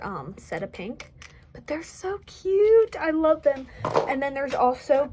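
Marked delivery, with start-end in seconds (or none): 1.5-3.7 high, slow squeal of delight; gushing. Real, not acted. Crafter showing off her earrings, cooing with delight. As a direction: coo over how cute they are.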